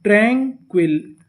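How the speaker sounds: Tranquil slowly, split as ट्रैं, quill; syllable-by-syllable reading. Shown as a demonstration. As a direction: draw out ट्रैं, pause, then quill. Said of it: "तो meaning क्या बनी".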